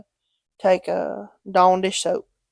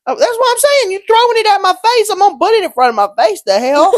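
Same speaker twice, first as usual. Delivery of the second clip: high, loud, rapid-fire protest; indignant outburst; 12 semitones higher, 8 dB louder than usual. Acted. Defending yourself over a ball thrown at your face, indignant. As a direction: blurt it out in comic outrage.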